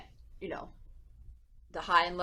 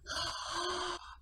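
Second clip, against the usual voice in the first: gasp; 9 semitones higher, 12 dB quieter than usual. Shown as a demonstration. Surprise in children's game.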